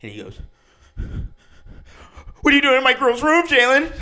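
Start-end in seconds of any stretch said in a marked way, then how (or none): 2.4-3.9 high, loud, blubbering yell of a heartbroken guy; crying ex-boyfriend. Retold or imitated. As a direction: wail it like a kid who's been crying for hours. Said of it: "this kid is fucking bawling his eyes out".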